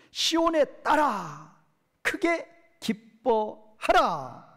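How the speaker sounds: very slow, high proclamation of 크게 기뻐하라; emphatic command. Shown as a demonstration. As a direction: draw out 크게 기뻐하라 like a solemn command. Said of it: "명령형이에요". (크게 기뻐하라를 아주 느리고 높게 선포하듯)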